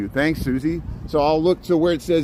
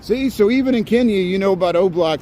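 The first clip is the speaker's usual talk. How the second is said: excited; 7 semitones higher than usual. Real, not acted. Excited reply to chat.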